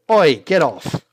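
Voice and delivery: man yanked from the queue shouts in surprise; surprised shout. Acted. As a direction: yelp it out as he's grabbed. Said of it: "He's gonna shout in surprise".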